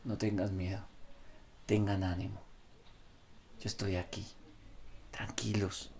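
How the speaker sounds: tender hush ending in a whisper; hushed reassurance. Retold or imitated. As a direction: comfort them quietly, almost whispering. Preacher voices Jesus, fading to whisper.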